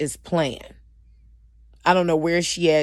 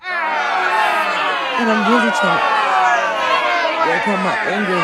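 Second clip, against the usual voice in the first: higher, louder and slow, the words pushed out with feeling; raised voice; 7 semitones higher, 6 dB louder, slower than usual. Real, not acted. Admitting to viewers she is working on anger, in a raised voice. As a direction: break into a strained near-shout. Emotional confession about her anger.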